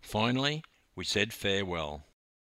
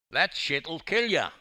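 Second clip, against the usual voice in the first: raised, cheeky street-talk warning; street lingo; 7 semitones higher, 4 dB louder than usual. A narrator's reading. Dawn to the smoker, in street lingo. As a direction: toss it out like a local. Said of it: "picking up the street lingo".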